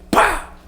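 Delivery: sharp, high popping blast; phone bursting sound. Retold or imitated. Telling the pastor's phone exploding mid-prayer, a sudden high pop. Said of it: "da pessoa fez assim".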